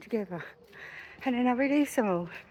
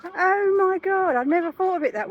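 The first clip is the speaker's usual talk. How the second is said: amazed neighbour; 10 semitones higher, 8 dB louder than usual. Retold or imitated. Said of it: "she's like".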